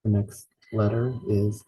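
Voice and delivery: ordinary delivery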